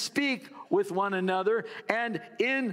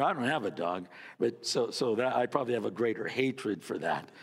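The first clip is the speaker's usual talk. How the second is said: normal talking voice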